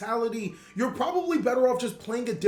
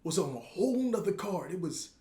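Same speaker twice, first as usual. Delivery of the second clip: put-on creaky old-timer reminiscing, trailing off; very old man; 5 dB quieter than usual. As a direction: grandpa mumbling to himself, fading quieter. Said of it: "it's Yogi Boomer old".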